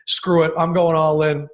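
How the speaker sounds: bold declaration. Retold or imitated. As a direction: declare it loudly, all in. Retelling going all in as fan.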